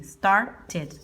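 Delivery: started as "star-tid"; ID ending. Shown as a demonstration. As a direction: give started two distinct syllables. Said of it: "Se pronunciaría así".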